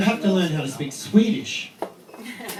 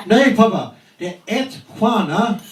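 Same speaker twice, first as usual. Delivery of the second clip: put-on higher child voice correcting dad, slowing at the end; imitating his son; 4 semitones higher, 4 dB louder, slower than usual. Eight-year-old son correcting his dad's Swedish, higher imitated child. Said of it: "He goes".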